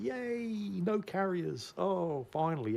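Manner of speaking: high, slow, hammed-up delight at no carriers; mock cheer. Player reading the enemy list, mock cheer. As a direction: stretch out a fake joyful yay. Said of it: "you think".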